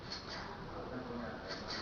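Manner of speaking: isolated whispered ft, lips then tongue; ft cluster sound. Shown as a demonstration. Teacher isolating the FT sound. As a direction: breathe out ft, ft. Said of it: "you have to pronounce like".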